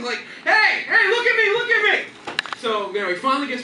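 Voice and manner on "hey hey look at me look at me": high, loud, laughing shout; yelling while waving. Retold or imitated. Grandfather waves his arms at the porch, laughing yell. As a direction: holler to get noticed, cracking up. Waving hands to get attention.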